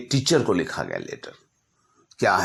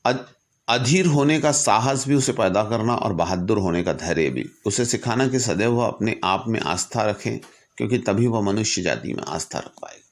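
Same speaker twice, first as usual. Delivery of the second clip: raised, earnest recitation of a letter; formal letter reading; 4 semitones higher, 4 dB louder than usual. Acted. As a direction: read it like a solemn public address. Reads Lincoln's letter aloud. (गंभीर भाव से पत्र का पाठ)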